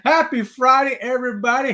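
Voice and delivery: ordinary delivery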